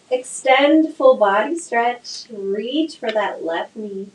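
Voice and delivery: normal talking voice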